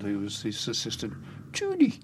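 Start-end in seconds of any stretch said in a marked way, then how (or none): 1.5-1.9 high, mock Newcastle accent. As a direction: call it out in put-on Geordie. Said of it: "That's my Newcastle accent".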